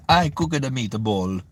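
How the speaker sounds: slow, hammed-up Italian accent; mock Italian cook's accent. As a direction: put on a playful, drawn-out Italian accent. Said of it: "for Italian accent".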